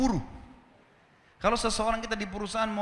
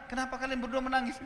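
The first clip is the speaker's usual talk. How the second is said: higher-pitched puzzled question; retold puzzlement; 7 semitones higher, 3 dB quieter than usual. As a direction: ask it puzzled, as if retelling. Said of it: "Orang ini juga heran". (menirukan orang yang heran, nada tinggi)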